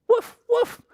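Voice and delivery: very high little woofs; puppy bark. A narrator's reading. Biscuit barks at the baby chicks, high yapping woofs. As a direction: yap like a small puppy.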